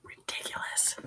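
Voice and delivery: exasperated whisper; fed-up whispered aside. Real, not acted. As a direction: breathe it out under the breath.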